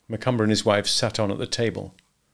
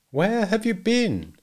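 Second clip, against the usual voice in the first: high, slow, insistent demand; insistent husband; 12 semitones higher, slower than usual. A narrator's reading. Husband to wife creeping back to bed, accusing. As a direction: press the question, drawing it out.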